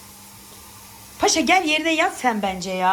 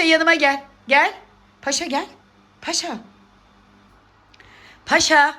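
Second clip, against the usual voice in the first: raised, higher calls with gaps between; short insistent summons; calling a pet; 5 semitones higher, 4 dB louder than usual. Real, not acted. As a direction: call the pet over to you.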